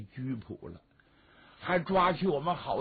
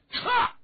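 high, loud burst of sound; quick high-pitched sound effect; sharp "cha" noise; 5 dB louder than usual. Acted. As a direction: punch out a sudden sharp "cha". Imitated noise in the battle retreat.

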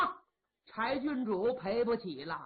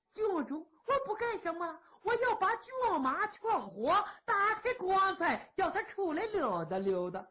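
playful teasing; 8 semitones higher than usual. Acted. Kou Zhun's teasing reply.

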